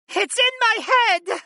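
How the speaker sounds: very high panicked shout; high-pitched female scream of distress; anguished shriek. A narrator's reading. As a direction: cry out as if invaded. Goddess cries out in anguish.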